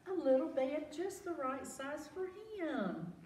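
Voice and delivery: high awed gasp then wonder; delighted gasp. A narrator's reading. Reader showing children the little bed, delighted wonder. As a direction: marvel at the tiny bed.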